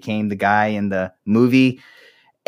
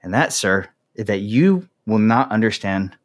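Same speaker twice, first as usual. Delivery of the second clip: ordinary talk, nothing marked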